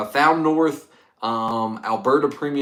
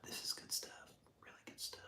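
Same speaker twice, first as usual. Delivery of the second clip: soft whisper savoring the sip; 26 dB quieter than usual. Real, not acted. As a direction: breathe it out in a hush, savoring. Savoring the whiskey quietly.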